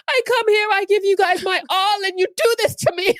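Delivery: high, loud tearful wail; mock crying. Teacher reproaches the class that pranked her, mock-wailing. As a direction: imitate a hurt teacher wailing, hammed up. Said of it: "She eventually starts crying".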